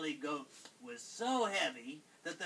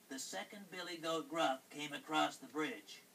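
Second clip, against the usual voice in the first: ordinary delivery, nothing marked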